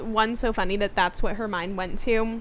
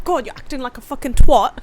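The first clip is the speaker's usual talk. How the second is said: loud, laughing mock British accent; hammed-up British insult, loud and half laughing; 2 semitones higher, 9 dB louder than usual. Shown as a demonstration. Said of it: "I slip into a British accent".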